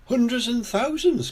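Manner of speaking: put-on high-pitched child's exclamation; excited cry. A narrator's reading. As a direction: cry out like a little girl. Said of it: "cries Peppa".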